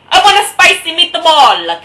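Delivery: loud, high hammed-up Italian; over-the-top Italian ad voice; Italian accent. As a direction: belt it like a cartoon Italian. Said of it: "There, I'm Italian".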